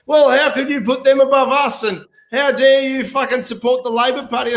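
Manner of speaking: hamming up the offended party faithful; mock outraged party member. Voters from both major parties scolding him, in a high indignant voice.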